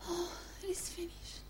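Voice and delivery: faint, airy sigh of defeat; imitating a spent last breath; feeble dying whisper. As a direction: whisper it weakly like a dying man. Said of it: "He didn't go".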